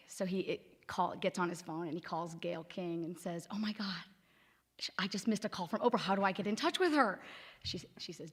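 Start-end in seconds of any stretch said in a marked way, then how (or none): 3.5-7.2 excited panic. Retold or imitated. Scott panics after missing Oprah's call.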